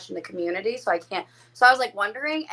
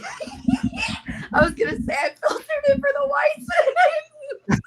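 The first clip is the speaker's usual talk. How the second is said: high, cracking laughter through words; can barely get it out for laughing; real laughing fit; laughing hard; slower than usual. Real, not acted.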